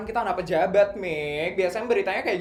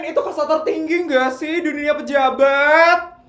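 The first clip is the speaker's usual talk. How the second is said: envious gushing; 8 semitones higher, 4 dB louder than usual. Acted. Envious friends gushing over Rafin.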